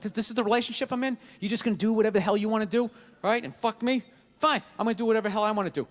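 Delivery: put-on rapid childish sulk; fast petty rant. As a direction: rattle off the petty grievance.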